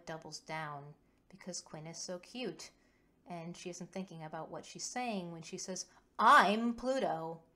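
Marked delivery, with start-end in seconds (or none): 6.2-7.4 loud, drawn-out bratty declaration. A narrator's reading. Kova tries to rile Quinn up more, loud and bratty. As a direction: stretch it out, high and loud, to provoke. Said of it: "trying to rile Quinn up more by being a brat".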